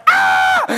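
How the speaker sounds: put-on sudden high little-girl squeal; mock child shriek. Father imitating his startled daughter, high squeal. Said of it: "my little girl goes".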